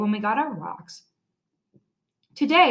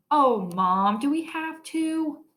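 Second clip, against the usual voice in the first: put-on whiny kid; 6 semitones higher, slower than usual. Peter whines to his mother.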